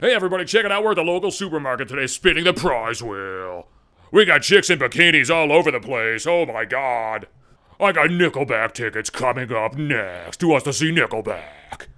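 cheesy radio DJ voice; hammed-up shock-jock patter; mock morning zoo DJ. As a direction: parody a hyped local radio host.

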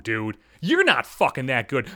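ordinary talk

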